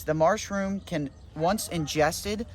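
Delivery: ordinary delivery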